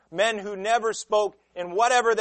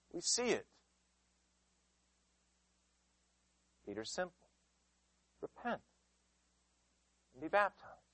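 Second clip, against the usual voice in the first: hushed and simple; 12 dB quieter than usual. Acted. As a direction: drop to a near hush. Sudden drop after the outcry.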